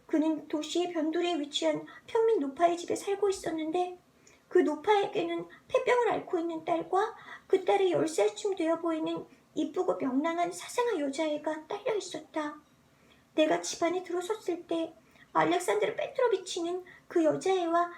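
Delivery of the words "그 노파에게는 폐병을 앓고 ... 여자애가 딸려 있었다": plain reading voice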